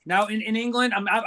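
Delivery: usual speaking voice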